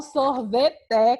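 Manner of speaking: sorvete drawn out slowly and loud, syllable by syllable. Shown as a demonstration. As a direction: split sorvete into slow, strong syllables. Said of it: "Você fez a separação".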